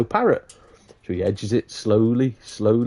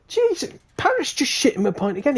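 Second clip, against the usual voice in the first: high-pitched mock outrage; exasperated protest. Customer complaining about his second fouled pint, high exasperation.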